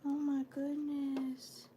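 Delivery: slow and soft, deeply touched; moved, quiet. Real, not acted. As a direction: let it out softly, shaken by the news. Realizing he died soon after.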